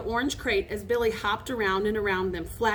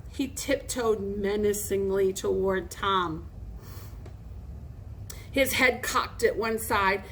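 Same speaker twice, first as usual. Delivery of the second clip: plain reading voice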